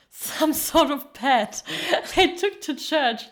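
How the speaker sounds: real laughter breaking up the words; laughing. Real, not acted. Laughing at pets taken to church.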